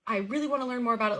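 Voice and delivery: excited cousin. Retold or imitated. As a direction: play the cousin gushing with excitement. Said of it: "gets excited and is like".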